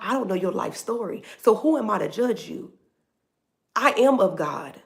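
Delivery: usual speaking voice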